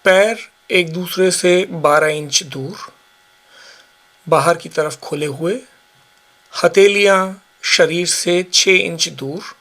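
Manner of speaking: ordinary talk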